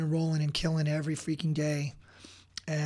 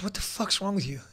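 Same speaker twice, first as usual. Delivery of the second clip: re-enacted harsh challenge, pitch slightly raised; angry confronting; 3 semitones higher, faster than usual. Husband confronting his crying wife in the kitchen, retold anger.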